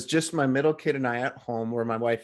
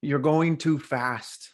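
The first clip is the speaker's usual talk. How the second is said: slow message; 2 semitones higher, much slower than usual. Retold or imitated. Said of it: "the message for me was".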